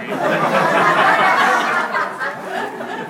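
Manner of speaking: big burst of laughter; loud laugh. Real, not acted. Host laughs at own rumor joke, loud laugh.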